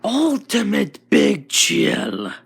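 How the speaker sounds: slow, loud, high transformation cry; shout. Acted. Izuku transforming, shouting his new form's name. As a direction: bellow the name as you transform. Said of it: "and he shouts".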